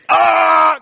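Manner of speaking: a high, loud mock shout of ah; mock belted ah. Teasing the non-singers, belting a mock "Ah!". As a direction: belt out the ah like a bad singer. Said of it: "you just want to say".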